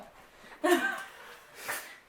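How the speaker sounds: breathy laugh as the dog licks; a quick burst of laughter; giggling at the dog. Real, not acted. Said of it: "You don't need to lick my face".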